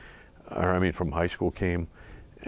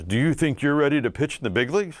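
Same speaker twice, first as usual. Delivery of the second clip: imitating a stern coach, higher and louder; mock scolding; 8 semitones higher, 5 dB louder than usual. Pitching coach pulls player off the bus, mock scolding. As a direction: scold in a raised, challenging voice, as a question. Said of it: "Like he was scolding me".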